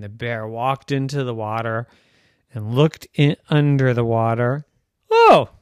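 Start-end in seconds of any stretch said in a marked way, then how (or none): 5.1-5.5 high, loud gasp of surprise; surprised oh. Acted. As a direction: discover it suddenly. Bear discovers unicorn.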